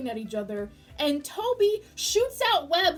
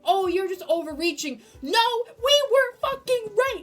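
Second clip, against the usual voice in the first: high, loud vindication; triumphant shout; 7 semitones higher, 8 dB louder than usual. Real, not acted. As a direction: yell it like you won the bet. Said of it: "Sorry for my incessant screaming".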